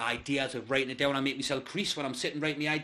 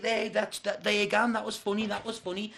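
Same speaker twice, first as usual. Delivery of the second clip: higher-pitched encouraging partner; lightly put-on female voice, pitched up; girlfriend's voice; 7 semitones higher than usual. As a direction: imitate her pleased, reassuring verdict. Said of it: "She was like".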